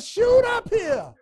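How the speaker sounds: high, loud, slow shouted plea; yelled plea. Retold or imitated. Hunter in the tree begging his friends to shoot, loud shout.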